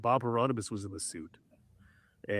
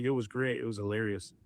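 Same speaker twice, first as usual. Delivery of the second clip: ordinary talk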